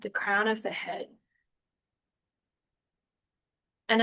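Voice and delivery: unhurried meditation guide with long pauses; calm, spacious guiding voice; guided meditation. Real, not acted. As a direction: ease them gently through breath and body, phrase by phrase.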